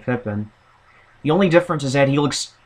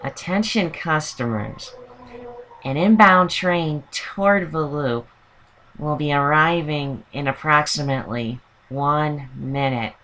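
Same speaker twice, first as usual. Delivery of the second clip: tired Fran Drescher; 3 semitones higher, 4 dB quieter than usual. Retold or imitated. Mimicking the annoying transit announcer for listeners, tired Fran Drescher voice. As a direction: drag out a train announcement in a hungover voice. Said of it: "imagine Fran Drescher if she were tired or hungover".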